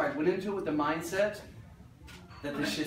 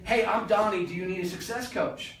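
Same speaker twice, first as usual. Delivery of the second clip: louder, higher hard-sell opener; pushy sales pitch; 5 semitones higher, 4 dB louder than usual. Shown as a demonstration. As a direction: pitch yourself like the guy nobody wants. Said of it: "That's more valuable to me than".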